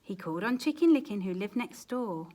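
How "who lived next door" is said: ordinary delivery, nothing marked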